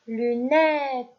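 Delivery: "lunettes" projected loud and clear; teacher dictating "lunettes"; dictation voice. Shown as a demonstration. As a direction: call out "lunettes" for the class to write. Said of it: "Je répète".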